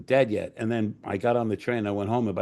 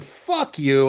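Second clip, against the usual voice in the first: loud, higher-pitched brush-off; dismissive retort; 4 semitones higher, 5 dB louder than usual. Retold or imitated. Writer hangs up on supposed prank caller, loud dismissive retort. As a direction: curse the prankster and be done.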